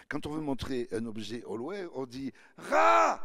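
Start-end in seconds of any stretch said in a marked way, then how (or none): 1.9-3.2 "rat" shouted high and drawn out slowly; clipped regarde. Shown as a demonstration. Showing how Toulousains say regarde, "rat" called out loud and slow. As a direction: call out "rat" long and loud. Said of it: "alors que c'est regarde".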